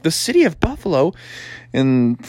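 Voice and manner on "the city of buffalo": quick, higher puzzled question; raised, hurried kid voice; young frog son. Acted. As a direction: query Dad as a little frog. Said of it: "And Froggy was like".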